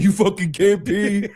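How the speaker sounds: high, slow taunt; hammed-up scornful doctor; mocking jeer. Patient imagining doctor laughing at him, jeering. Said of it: "pointing and laughing".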